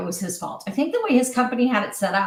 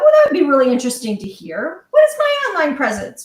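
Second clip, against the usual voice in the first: higher, louder voiced thought; keen curiosity; 4 semitones higher, 6 dB louder, faster than usual. Woman retelling her hopeful thought before a sales call, keen curiosity. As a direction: act out an intrigued inner voice.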